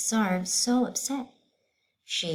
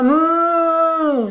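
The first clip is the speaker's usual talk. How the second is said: loud, high mooing cow; 11 semitones higher, 12 dB louder than usual. Acted. Cow interrupting the teacher, loud moo. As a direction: belt out a big cow moo. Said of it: "said the cow".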